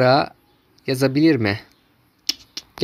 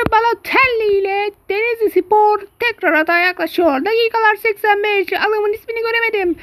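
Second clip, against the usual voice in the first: much higher, hyped-up play-by-play; excited commentator; 3 dB louder than usual. Acted. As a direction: call the match in a high frantic voice. High excited match commentary.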